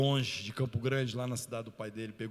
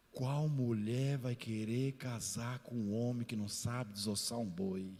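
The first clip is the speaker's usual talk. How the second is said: slow, heavy quote; slower than usual. Retold or imitated. Father's cruel words.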